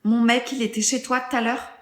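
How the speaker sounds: ordinary talk, nothing marked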